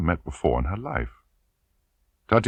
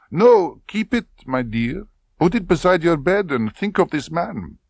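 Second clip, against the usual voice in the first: high, loud, probing; insinuating colonel; 11 semitones higher, 4 dB louder than usual. Acted. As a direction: lean in on the question. Klebb continues.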